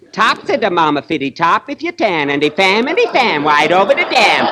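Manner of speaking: put-on high lisping baby talk; mock a singer's baby lisp. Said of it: "with that baby talk of yours".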